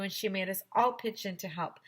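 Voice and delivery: ordinary delivery, nothing marked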